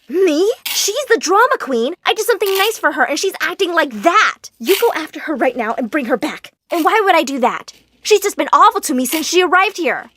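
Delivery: high, loud indignant daughter; defensive retort. Acted. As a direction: argue back hotly. Daughter arguing back at mom.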